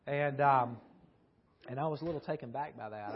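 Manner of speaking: ordinary talk, nothing marked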